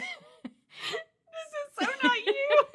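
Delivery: can barely get it out for laughing. Real, not acted. Tells her co-host she is out of character, laughing hard.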